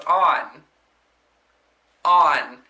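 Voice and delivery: loud, barked repeated word; mock demand. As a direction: insist stubbornly. Resident demanding lights.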